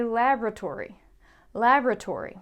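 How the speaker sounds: laboratory said in American style, LAB-ra-tory, stress up front; American laboratory, first beat stressed and clipped. Shown as a demonstration. Teacher showing the American way to say laboratory. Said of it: "if you're American".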